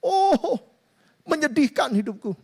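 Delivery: high, hammed-up whimpering to God; rising into a mock wail on Oh; mock wailing lament. Troubled believer laments his miserable life, hammed-up wail. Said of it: "katanya begitu".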